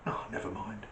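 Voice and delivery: hushed, baffled aside to himself; acted under-the-breath bewilderment; puzzled thought. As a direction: think it aloud softly, then shrug it off. Man's puzzled thought in a joke.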